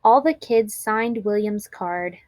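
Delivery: plain reading voice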